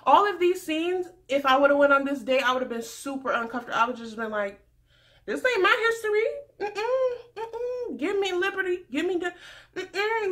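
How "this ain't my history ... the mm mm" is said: high-pitched, indignant no-thank-you; mock refusal. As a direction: play up an uncomfortable, high protest. Imagined refusal on the date.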